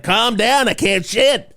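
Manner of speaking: high-pitched comic complaint; hammed-up high protest; mock exasperated plea. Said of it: "I'm just like".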